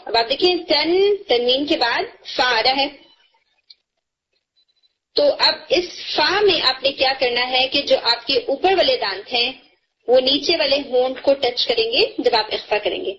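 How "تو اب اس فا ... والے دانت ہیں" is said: ordinary talk, nothing marked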